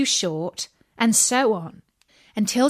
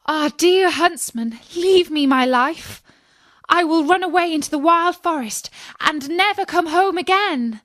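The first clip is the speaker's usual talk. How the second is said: put-on high, urgent little girl's plea; tearful begging; 8 semitones higher, 4 dB louder than usual. A narrator's reading. Little girl begging the huntsman for mercy, high and pleading. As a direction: plead for your life in a raised child's voice. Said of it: "the little girl cried and begged him".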